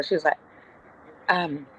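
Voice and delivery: ordinary delivery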